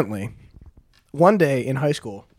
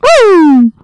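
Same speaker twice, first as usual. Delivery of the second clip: high loud siren wail; siren imitation; 11 dB louder than usual.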